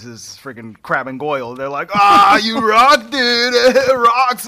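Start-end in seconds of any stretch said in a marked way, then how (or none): 1.9-4.5 put-on loud, high jeering laugh at the kid; hammed-up bully sneering through laughter; mocking bully taunt. Bullies mocking Barney's rock gift.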